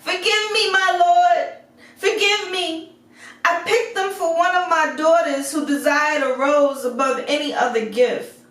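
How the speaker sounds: very high, pleading cry for mercy; begging in fear. A narrator's reading. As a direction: grovel and beg the beast. Merchant begs on the ground.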